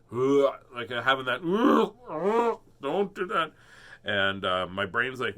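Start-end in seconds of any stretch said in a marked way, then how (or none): 1.4-3.5 high, frightened little voice for the stomach; a hammed-up voice for a nervous stomach. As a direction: make the stomach beg you not to.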